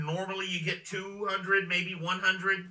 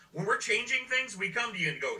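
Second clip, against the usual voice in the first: normal talking voice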